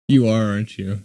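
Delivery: normal talking voice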